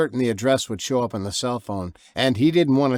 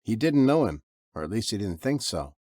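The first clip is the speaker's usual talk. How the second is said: usual speaking voice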